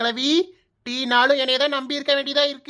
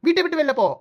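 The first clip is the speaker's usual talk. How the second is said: angry order; 4 semitones higher than usual. Acted. Wife ordering her sister-in-law out of the house, angry.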